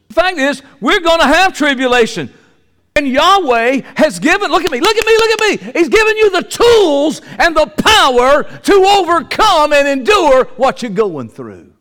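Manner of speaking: high, loud preaching build; preaching at full force. Acted. Preacher urging the congregation to see God's power, loud and high. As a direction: drive the point home to the congregation.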